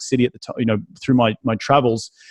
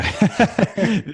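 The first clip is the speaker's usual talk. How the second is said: short laugh; 10 semitones higher than usual. Real, not acted. Laughs at own joke.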